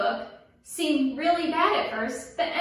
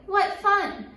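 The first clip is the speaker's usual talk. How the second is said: high, gleeful exclamation; playful delight; 7 semitones higher, 3 dB louder than usual. A narrator's reading. Storyteller voicing the baboons' fun at vine swinging, delighted. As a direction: pipe it up with storybook glee.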